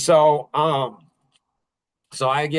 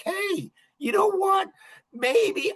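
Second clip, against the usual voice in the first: gentle coaxing; slower than usual. Retold or imitated. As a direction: ease into it gently. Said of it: "just gently massage this thing".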